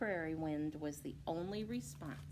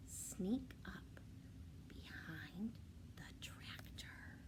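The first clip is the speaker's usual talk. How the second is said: softer, breathy whisper; 11 dB quieter, slower than usual. A narrator's reading. Narrator telling the sneaking wolf, whispered. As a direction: hush it like sneaking up.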